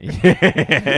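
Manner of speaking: a loud yeah through laughter; laughing yeah. Real, not acted. Friend laughing at the safety vest joke. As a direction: laugh it out as you agree.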